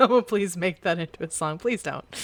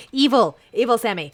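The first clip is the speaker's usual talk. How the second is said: mock accusing; 4 semitones higher, 7 dB louder than usual. Real, not acted. Accusing a friend jokingly.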